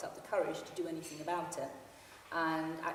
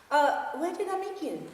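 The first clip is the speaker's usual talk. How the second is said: said quickly; 9 semitones higher, 6 dB louder than usual. Acted. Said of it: "I said quickly".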